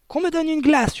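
high, loud command; father's yell. A narrator's reading. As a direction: yell an urgent order to the room. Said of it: "hurla le père". (hurlement impérieux du père)

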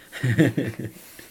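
short bright burst of laughter before answering; a real, amused laugh, a little loud. Real, not acted. English teacher laughing at the question about the region.

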